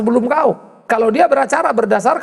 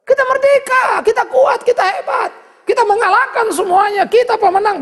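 triumphant proclamation; 11 semitones higher than usual. Acted. A preacher rallying the church, with a high triumphant shout. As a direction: shout the victory to the congregation.

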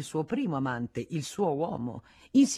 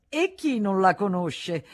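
higher, louder jeering question; loud mocking taunt; 5 semitones higher, 7 dB louder, slower than usual. A narrator's reading. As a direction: throw it out as a provocation. Taunt before the stammered repeat.

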